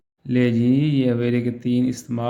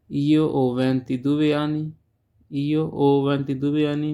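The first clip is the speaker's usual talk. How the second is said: slow model sentence; much slower than usual. Shown as a demonstration. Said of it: "teacher models Italian slowly, twice".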